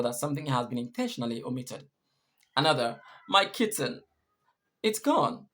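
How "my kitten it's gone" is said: higher-pitched, broken lament with a pause between phrases; mildly acted sobbing. Teacher reads a crying boy's line, mock sobbing. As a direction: lightly mimic a little boy's sob. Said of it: "sobbed the little boy".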